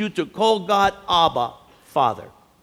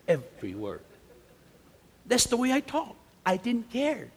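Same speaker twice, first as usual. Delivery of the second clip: ordinary delivery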